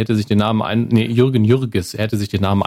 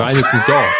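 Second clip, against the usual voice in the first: laughing shout; 2 semitones higher than usual. Real, not acted. Repeats the name, bursting out.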